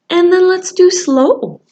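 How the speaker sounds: ordinary delivery, nothing marked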